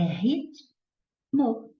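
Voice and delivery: "ritmo" broken into rit... mo; syllable by syllable. Shown as a demonstration. Teacher showing where to break the word, "ritmo" in separate syllables. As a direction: leave a long gap inside "ritmo" after rit. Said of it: "divisão silábica".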